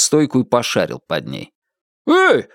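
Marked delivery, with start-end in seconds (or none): 2.1-2.5 very high indignant yelp; startled high protest. A narrator's reading. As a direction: call out in outrage, voice shooting up. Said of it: "возмутился хозяин".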